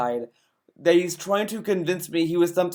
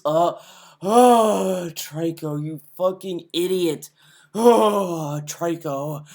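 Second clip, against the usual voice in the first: imitating angry gamer; slower than usual. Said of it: "said, like".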